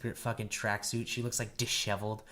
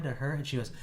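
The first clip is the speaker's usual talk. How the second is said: usual speaking voice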